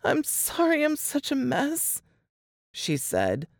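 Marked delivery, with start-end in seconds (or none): 0.0-2.0 higher, tearful apology with a quiver. A narrator's reading. Laura apologising to Jess and Nate, voice quivering. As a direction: apologise through a shaky voice. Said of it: "her voice quivering".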